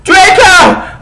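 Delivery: very high loud yell of a name; shouted. Acted. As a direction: yell the name across the field. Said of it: "I shouted".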